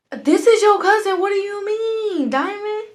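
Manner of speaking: high, loud shocked questioning; acted disbelief. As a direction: cry out in mock astonishment. Cousin's shocked reaction, retold.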